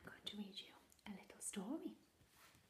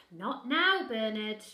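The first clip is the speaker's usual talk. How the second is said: dismissive mother; 19 dB louder, much slower than usual. Acted. Mother ignoring the monster, dismissive. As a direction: sigh and shoo it off.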